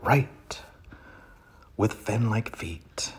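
dramatic poetry reading with sudden swells; poem reading. Acted. Comic reader performing a poem to his audience, hammed up. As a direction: reads the duck poem with grand pauses.

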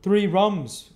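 loud, high call to the landlord. A narrator's reading. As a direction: call the order across the inn. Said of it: "cried the stranger, calling to the landlord".

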